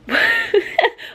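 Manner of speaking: very high squeal of laughter; high-pitched laugh. Real, not acted. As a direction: let out a wordless, squeaky laugh. Reacting to book, no words.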